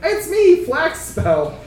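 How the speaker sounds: lightly voiced elf woman, starting high. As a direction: answer as a hint of a delighted elf woman. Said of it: "an elf woman turns".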